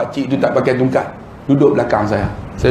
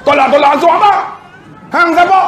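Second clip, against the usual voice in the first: higher, louder furious challenge; angry shout; 13 semitones higher, 5 dB louder, faster than usual. Retold or imitated. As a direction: bark at the stranger who interferes. Kerani marah pada penceramah. (kerani tengking penceramah yang masuk campur)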